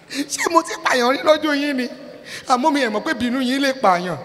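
high, fast, indignant Yoruba retort; exasperated retort. Retold or imitated. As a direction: fire back in disbelief, fast and high.

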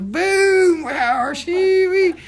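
high loud hollered whoop; mock whoop. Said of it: "They go".

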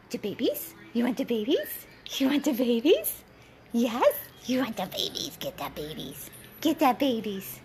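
playful cooing with lilting questions; coaxing pet talk. Real, not acted. Owner offering the dog her toy babies, coaxing baby talk.

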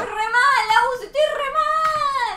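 high, loud, drawn-out whine; loud gamer lament, stretched out; distressed wail. Real, not acted. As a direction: wail your despair at your game partner. Losing player wails to partner.

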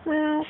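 high, soft imitated meow; high mock meow. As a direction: meow up at the kitty playfully. Meowing at a cat.

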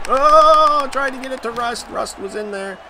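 high, loud excited yelp that settles; sudden gamer excitement, pitch way up; excited outburst. Real, not acted. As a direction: burst out thrilled at the play. Gamer reacting to a hit.

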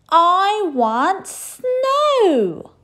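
high, loud, very slow drawn-out whine; whiny demand. A narrator's reading. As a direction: stretch the refrain out.

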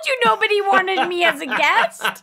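loud, high mock protest; mock outrage. Guest jokingly protesting to the host about the letter, mock outraged. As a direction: playfully wail it over the laughter.

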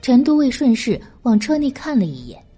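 plain reading voice

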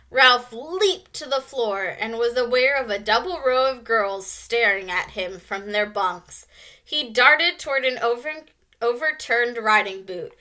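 plain reading voice

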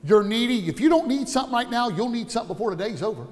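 normal talking voice

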